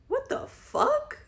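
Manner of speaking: high, loud, slow what the fuck; exasperated outburst. Real, not acted. Venting about the man to viewers, incredulous high outburst. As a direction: throw up your hands in disbelief.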